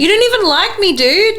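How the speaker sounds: high-pitched exasperated protest; mock disbelief. Protesting to someone who won't leave, high mock disbelief. Exasperated retort voiced in the story.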